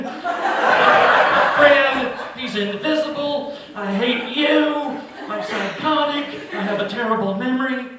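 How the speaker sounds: high, loud, slow manic rant; manic confession. Retold or imitated. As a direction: unload every crazy secret at once. Wife unloads baggage, ranting.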